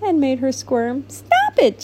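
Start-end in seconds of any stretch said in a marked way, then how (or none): 1.1-1.8 a very high squeak of a catfish; squeaky protest. Acted. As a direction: squeak it out high and shrill-pitched. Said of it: "she squeaked".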